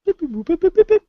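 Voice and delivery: very high robotic beeps; old speech synthesizer. Pretend. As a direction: chirp like a little droid. Mimicking a computer hacking.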